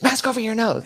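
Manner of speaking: mock agent, high and loud; imitated insistent yell. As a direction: repeat the order like an exasperated official, hammed up. Agent repeats order, retold.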